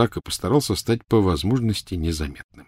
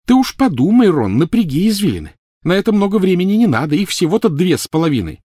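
heavy sarcasm; 11 semitones higher, 6 dB louder than usual. Acted. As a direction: lean on the sarcasm. Hermione mocking Ron.

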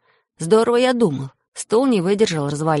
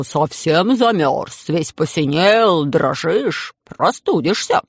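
lightly voiced crocodile, slow; a hint of a drawn-out beast voice; crocodile speaking; 3 dB louder, much slower than usual. A narrator's reading. Crocodile tells the freezing boy he'll catch cold, slow and lightly voiced. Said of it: "и говорит человеческим голосом".

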